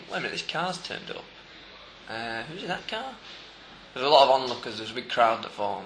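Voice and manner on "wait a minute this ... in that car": soft, high puzzled wondering; imagined police musing. Acted. Police spotting a suspicious car, acted soft high musing. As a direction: think it aloud quietly, as the police. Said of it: "Why weren't they thinking".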